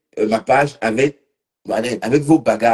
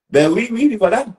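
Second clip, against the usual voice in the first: much higher, louder sarcastic yes-yes; mock agreement; 11 semitones higher, 4 dB louder than usual. Answering a viewer in a high, sarcastic mock yes. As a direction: toss off a squeaky sarcastic yes.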